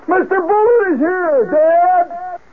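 put-on very high, drawn-out child's holler; kid's yell. Kid calls upstairs to dad in the shower, shrill-pitched yell. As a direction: imitate a kid calling up the stairs. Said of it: "It's my kid's voice".

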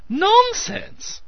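high loud cry; cried out. A narrator's reading. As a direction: cry it out sharply. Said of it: "cried Meadows".

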